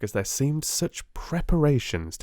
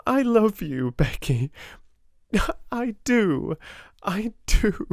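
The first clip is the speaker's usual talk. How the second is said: heartfelt; 12 semitones higher than usual. A narrator's reading. Sarah's emotional declaration.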